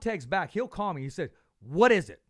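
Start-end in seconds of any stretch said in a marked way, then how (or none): 1.6-2.2 put-on Brad Goodman's accent. Host mimics Brad's accent answering a call, much louder. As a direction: bark it down the phone in his accent, much louder. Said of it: "he has that accent".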